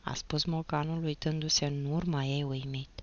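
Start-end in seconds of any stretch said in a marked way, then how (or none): none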